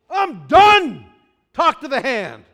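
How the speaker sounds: loud, high, sharp brush-off; fed-up dismissal. Retold or imitated. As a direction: slam the door on the talk. Frustrated person quitting.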